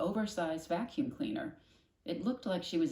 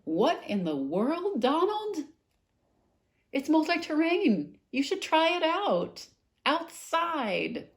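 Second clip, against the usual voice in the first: mother's voice; 12 semitones higher, 8 dB louder, slower than usual. Acted.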